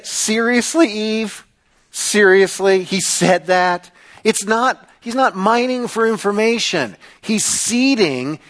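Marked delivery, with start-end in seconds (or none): 0.0-3.9 scoffing, incredulous tempter; mocking disbelief. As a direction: play the serpent needling Eve in a raised pitch. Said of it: "or mocking the command".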